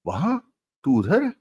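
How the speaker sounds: incredulous high, rising questions; surprised. A narrator's reading. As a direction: question it in sharp surprise, pitch shooting up. Told the hideout is the farmhouse.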